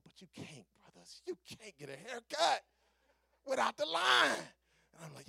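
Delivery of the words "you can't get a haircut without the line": raised, loud comic protest; emphatic holler. Acted. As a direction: build to a high shout.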